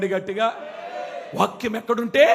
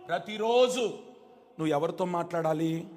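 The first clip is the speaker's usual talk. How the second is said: ordinary talk, nothing marked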